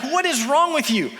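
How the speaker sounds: high-pitched acted frustration; exasperated. Balaam beating the donkey that crushed his foot, exasperated. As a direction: scold in rising exasperation.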